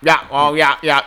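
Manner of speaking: lightly voiced yeah-man sidekick; other guy agreeing. Second worker answering the hyped dude, lightly voiced agreeing. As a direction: repetitive yeahs, a hint of character. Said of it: "then there's another guy just like".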